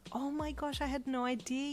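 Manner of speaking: high-pitched amazed gasp; delighted surprise; learning a friend's talent, high excited gasp. A narrator's reading. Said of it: "you gasped excitedly".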